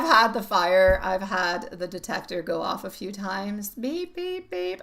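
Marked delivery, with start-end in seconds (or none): 3.7-4.8 imitated beeping. Imitating smoke detector.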